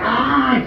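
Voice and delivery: high, sudden "Ah!" cry; acted yell. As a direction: let out a high mock yell. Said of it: "I'm going".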